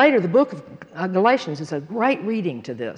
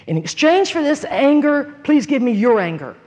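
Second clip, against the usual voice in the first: higher-pitched, forceful pleading; insistent retold prayer; 6 semitones higher, 4 dB louder than usual. Woman asks the Lord for his anger, insistent retold prayer. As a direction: press hard on each phrase of the prayer.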